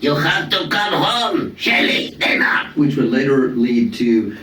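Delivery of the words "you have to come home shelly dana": put-on higher, slow shout calling the kids home; smoker neighbor calling out. Neighbor calling her kids home, in a high slow shout. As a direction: yell the kids home like a chain-smoking neighbor. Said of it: "she smoked a lot, and she goes".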